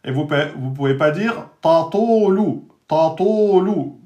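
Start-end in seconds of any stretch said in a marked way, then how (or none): 1.6-4.0 "ta to lou" with the first ta made heavy, slowly; wrong heavy first ta. Shown as a demonstration. Teacher shows the class a mistake, slowly. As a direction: show the error of emphasizing the first ta. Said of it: "vous avez emphatisé le premier ta".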